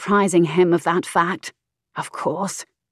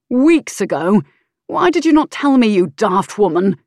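louder, angry outburst; angry shout; 3 semitones higher, 6 dB louder than usual. Acted. Earl berating his mistress over the letter, angry shout. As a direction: rage at her for hiding it.

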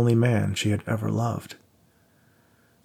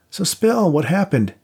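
a hint of a higher, teasing pal; lightly voiced buddy; 8 semitones higher, 4 dB louder, slower than usual. A narrator's reading. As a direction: play the cocky friend lightly. Voiced friend Max.

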